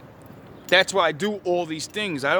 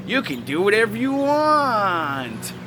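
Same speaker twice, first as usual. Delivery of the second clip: slow, sing-song mockery of "You can do whatever you want"; mock naive parent; 3 semitones higher, slower than usual. Mocking permissive parents' advice.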